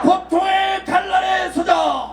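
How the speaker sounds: slow, shouted slogan line; roaring rallying speech. Real, not acted. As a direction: lead the crowd chant at full voice. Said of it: "제가 선창할 테니까 따라해 주시기 바랍니다". (천천히 크게 외치는 선창 구호)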